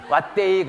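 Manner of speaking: 왓데이 said as Gyeongsang "it's come"; dialect exclamation. Retold or imitated. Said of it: "그랬어요".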